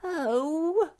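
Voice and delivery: high, whiny groaning Oh; piping, sorry-for-himself moan. A narrator's reading. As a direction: moan it out like a sick little creature. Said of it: "he moaned".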